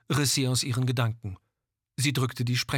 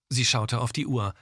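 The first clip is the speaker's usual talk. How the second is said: usual speaking voice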